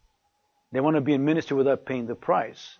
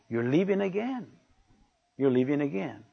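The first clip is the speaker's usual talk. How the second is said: soft-spoken retelling; 5 dB quieter than usual. Retold or imitated. Preacher first retells his wife's words at the door, soft-spoken. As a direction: quote the wife softly, held back. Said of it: "she didn't say it in that tone of voice".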